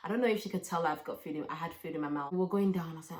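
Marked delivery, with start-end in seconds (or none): none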